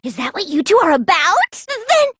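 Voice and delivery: normal talking voice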